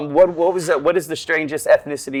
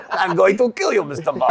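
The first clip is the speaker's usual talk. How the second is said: Bond villain voice; 4 semitones higher than usual. Retold or imitated. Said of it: "You look like a villain in a Bond movie".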